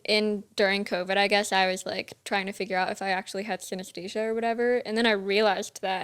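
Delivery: usual speaking voice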